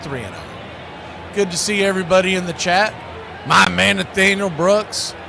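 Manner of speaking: ordinary talk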